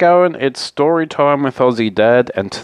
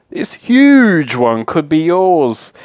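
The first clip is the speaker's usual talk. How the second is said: high, loud, stretched-out enthusiasm; excited sales pitch; 7 semitones higher, 5 dB louder, slower than usual. Acted. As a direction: sell it big, stretching the key word. Fox selling a huge cave.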